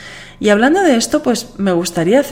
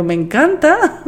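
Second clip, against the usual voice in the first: high, bright delighted exclamation; 9 semitones higher than usual. Retold or imitated. Host telling a listener she loves the attention, delighted. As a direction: gush with a burst of joy. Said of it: "Y digo, Guau".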